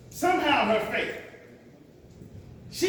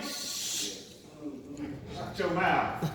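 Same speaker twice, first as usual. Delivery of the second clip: hushing her, then a low dismissive shut your mouth; disdainful hush; 9 semitones lower, 6 dB quieter, much slower than usual. Acted. Disciples shushing the woman, dismissive and lower.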